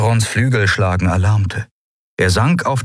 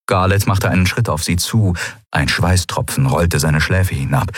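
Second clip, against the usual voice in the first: ordinary delivery, nothing marked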